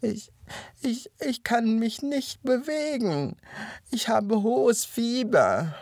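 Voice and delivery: high-pitched, halting sickly whine; whining, wailing. A narrator's reading. Hare feigning fever to the hyena, whining. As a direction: plead feebly, stumbling over the first word. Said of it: "jammerte der Hase zähneknirschend".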